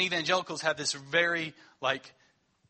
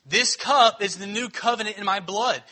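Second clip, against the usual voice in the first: louder and higher, pressing; emphatic quote; 4 semitones higher, 8 dB louder than usual. Acted. Preacher hammering the verse to the congregation, loud. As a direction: proclaim it hard to the room.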